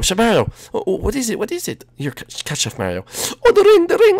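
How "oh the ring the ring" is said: excited. Acted. Ring thrown back in panic.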